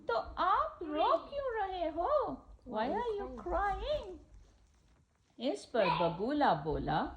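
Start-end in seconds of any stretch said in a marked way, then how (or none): 0.1-2.4 high, curious crab voice; crab's question. Acted. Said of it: "केकड़े ने फिर पूछा". 2.7-4.2 high, slow crab voice; crab's question. Acted. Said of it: "केकड़े ने फिर पूछा".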